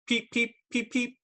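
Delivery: tiny high chick peeping; high squeaky hatchling peeps; baby bird peeps. Retold or imitated. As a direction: peep like a newborn chick.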